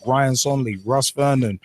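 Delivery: ordinary delivery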